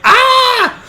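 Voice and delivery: loud, high-pitched yelp; kid's scream. Acted. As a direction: shriek like a kid grabbed by the ear. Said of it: "And the kid's like".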